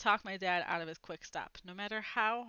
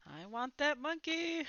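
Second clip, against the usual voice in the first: put-on higher, slow whiny little boy; slow, pleading childish want; anxious kid; 6 semitones higher than usual. A narrator's reading. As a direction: whine it like a kid set on a prize. Jack growing anxious in van.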